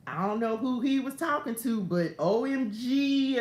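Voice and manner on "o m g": loud, drawn-out O M G; exclaimed as written. Acted. Telling viewers of the stranger's words, loud drawn-out exclamation. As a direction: stretch the letters and hit G hard, higher.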